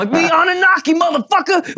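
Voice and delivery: high shouted rant. Retold or imitated. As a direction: yell it like a wild conspiracy reveal. Imagined [name] ranting.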